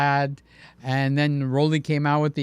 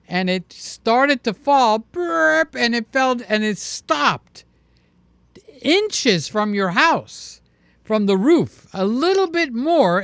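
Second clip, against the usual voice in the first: dramatic slow telling; 5 semitones higher, slower than usual. Real, not acted. Dramatic tree fall story.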